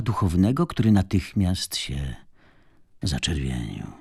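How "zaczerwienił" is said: ordinary delivery, nothing marked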